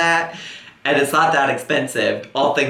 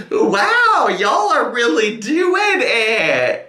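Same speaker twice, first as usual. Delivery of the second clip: shocked shout; 7 semitones higher, much slower than usual. Real, not acted. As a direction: shout your disbelief, dragging it out. Shocked by confession.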